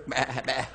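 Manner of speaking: soft, low mock bleating; sheep bleat. Imitates a sheep again.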